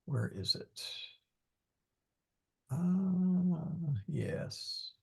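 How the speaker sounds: very soft, half-voiced searching mutter; hushed, distracted self-talk; muttering to oneself. Real, not acted. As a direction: think aloud under your breath. Muttering while hunting for a slide.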